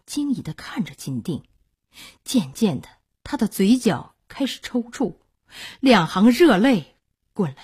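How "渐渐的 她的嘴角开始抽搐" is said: ordinary delivery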